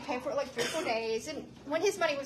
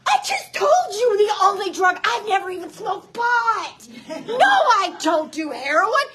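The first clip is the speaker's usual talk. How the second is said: loud, stretched-out offended protest; mock outrage; 5 semitones higher, 8 dB louder, slower than usual. Denying heroin to detox worker, comically outraged. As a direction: shout it back, hammed-up and affronted. Indignant retort about heroin question.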